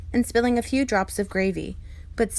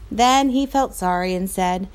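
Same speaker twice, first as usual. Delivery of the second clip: ordinary delivery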